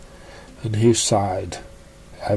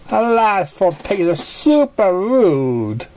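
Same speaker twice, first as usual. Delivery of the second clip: high, drawn-out pony voice on a slow ending; character voice; 8 semitones higher, slower than usual. Acted. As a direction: stretch the last words out like a cartoon pony. Character line in read story.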